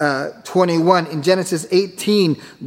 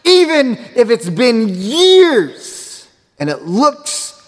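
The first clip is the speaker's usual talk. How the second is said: full-force preaching; 4 semitones higher, slower than usual. Acted. Preacher urging the congregation to believe the promise, slow and soaring. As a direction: build to the sermon's peak, stretching words.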